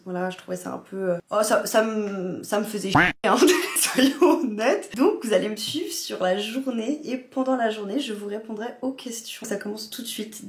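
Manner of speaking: ordinary talk, nothing marked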